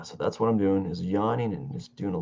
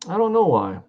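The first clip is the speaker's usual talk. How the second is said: higher, louder puzzled outburst; louder, higher "I don't know why"; exasperated; 10 semitones higher, 7 dB louder than usual. Real, not acted. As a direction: throw up your hands. Exasperated self-question.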